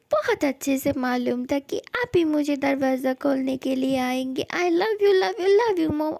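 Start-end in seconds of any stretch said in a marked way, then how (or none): 4.5-6.2 higher, louder burst of love you; gushing. Acted. Son hugging his mother at the door, gushing. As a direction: gush it at her.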